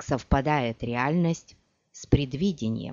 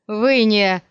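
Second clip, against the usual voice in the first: loud, higher startled break-off; shocked; 5 semitones higher, 8 dB louder than usual. Acted. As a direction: cut off by shock. (испуганный возглас)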